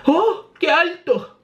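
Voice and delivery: high, drawn-out cry of dismay; acted fright at a height; slow, squeaky alarm; worried. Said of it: "se preocupa uno de ellos".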